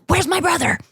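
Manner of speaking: put-on high, eager little-kid voice; imitating little son. Mom voices son seeking his new brother, high and eager. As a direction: mimic a small child demanding to see someone. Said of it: "And he's like".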